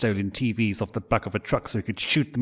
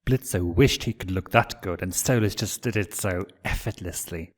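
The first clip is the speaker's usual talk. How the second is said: ordinary delivery, nothing marked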